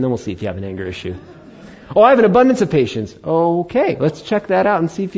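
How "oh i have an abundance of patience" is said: high, loud, fast mock boast; a hammed-up boast of patience; smug boast. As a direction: brag about your patience, a little smug. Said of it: "which I was like".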